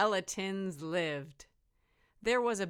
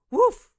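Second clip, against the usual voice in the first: high loud yelp; 17 dB louder than usual. Acted. Dog skeleton crashes into tree.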